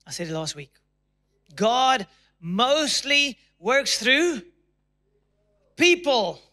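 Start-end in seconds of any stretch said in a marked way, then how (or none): 1.6-6.4 drawn out, stress on through then people; slow emphatic. Shown as a demonstration. Preacher to congregation, slow stressed line. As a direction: preach it slowly, landing hard on "people". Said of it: "Come on, say people".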